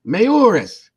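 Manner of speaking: Mayoris! yelped high and loud in sudden recognition; excited yell. Retold or imitated. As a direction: fire Mayoris! out as a startled cry. Old man shouts the name back.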